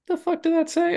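high-pitched puzzled double-take; mock double-take. Scroller catching a post while doom scrolling, puzzled. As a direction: do a high, baffled second look.

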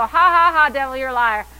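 loud, forced ha-ha into a taunt; mocking laugh. Believer answering the devil's lies, laughing defiantly. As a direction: laugh in the enemy's face. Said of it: "I go".

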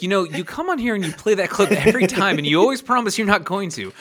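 high-voiced teasing host impression; imitating Conan. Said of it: "he's like".

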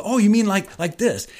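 eager student checking they've understood; dawning realization. Retold or imitated. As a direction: act out the penny dropping. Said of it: "it was like one by one".

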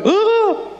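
high-pitched mock gasp of alarm; startled yelp. Preacher imitating his startled prayer group, a startled yelp. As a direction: yelp as if you saw something creepy. Congregation startled as girl convulses.

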